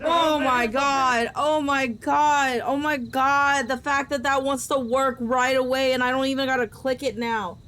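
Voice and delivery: panicked exclaiming. Real, not acted. Player shocked that it works right away, loud panic. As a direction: cry it out loudly, stretching each "god". Panicked reaction to sudden game event.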